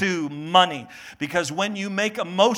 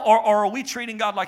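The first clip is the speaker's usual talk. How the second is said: ordinary delivery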